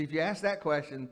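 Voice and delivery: ordinary delivery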